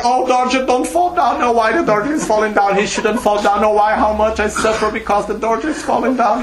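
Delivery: high, whiny mock wailing over the falling dorje; mock crying lament. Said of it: "To stay crying".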